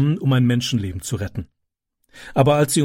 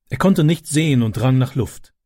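ordinary talk

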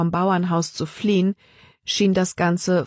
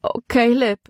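high, plaintive whine; wailing; 6 semitones higher than usual. A narrator's reading. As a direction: wail helplessly to a companion. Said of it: "jammerte Libby".

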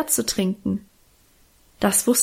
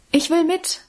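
put-on higher, eager child's call; 7 semitones higher than usual. A narrator's reading. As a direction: call out eagerly to be taken along. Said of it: "rief da Alwine". (Alwine ruft höher und eifrig, dass sie mitwill)

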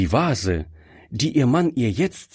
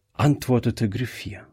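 usual speaking voice

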